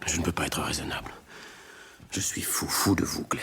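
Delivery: soft unvoiced plea of love; hushed, pleading whisper; whispered confession. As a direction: breathe the confession so nobody hears.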